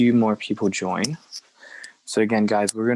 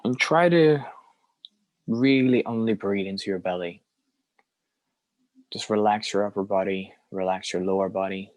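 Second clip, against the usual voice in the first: unhurried phrases with very long silences; guided meditation. Acted. Teacher leading a group through belly breathing, guided meditation. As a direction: guide each breath, leaving room to follow.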